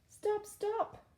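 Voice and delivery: high shouted stop; queen shouting. Acted. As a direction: call the king to stop. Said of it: "shouted the queen".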